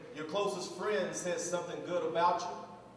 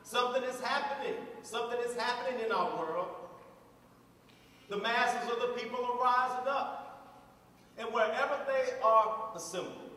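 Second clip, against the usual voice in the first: ringing sermon oratory with weighty pauses; stirring pulpit speech; impassioned preaching; 4 semitones higher, 3 dB louder than usual. Real, not acted. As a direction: preach it to the crowd phrase by phrase, building with conviction. Preaches to a crowd.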